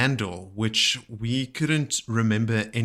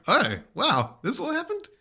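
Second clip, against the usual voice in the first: high, fake-amazed gasp; mock shocked. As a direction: act dazzled by old news.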